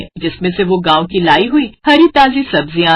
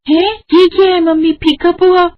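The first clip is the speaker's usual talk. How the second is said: much higher, startled whine; shocked child; 11 semitones higher than usual. Acted. Children shocked at bland poha, high startled whine. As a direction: recoil at the bland taste.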